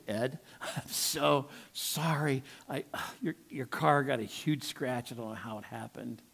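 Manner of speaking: hushed, halting confession with a sigh; sheepish apology. Retold or imitated. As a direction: re-enact owning up, dreading the reaction. Acted sheepish apology to truck's owner.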